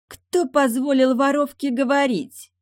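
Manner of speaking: high, loud, slow haughty outrage; spoiled girl's scornful outburst; spitting contempt. A narrator's reading. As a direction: spit it out at someone beneath you. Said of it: "почти выплюнула девушка".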